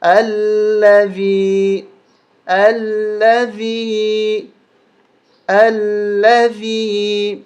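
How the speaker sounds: الذی very slow, a-sound on the alif; slow drill of alladhi. Shown as a demonstration. Teacher drills الذی very slowly for students. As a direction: drag out الذی syllable by syllable. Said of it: "ہم یہاں پر زبر لگا کے پڑھیں گے".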